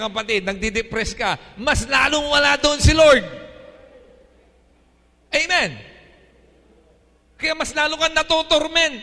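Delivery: loud, high, driving sermon delivery; full-force preaching. Acted. Pastor exhorting the congregation in a loud, high preaching voice.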